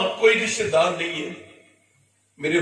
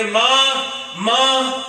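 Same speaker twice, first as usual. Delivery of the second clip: raised, wailing call of mother; grieving cry; 6 semitones higher, 5 dB louder, much slower than usual. Retold or imitated. Prophet at his mother's grave, loud grieving call. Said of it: "حضور فرماتے ہیں".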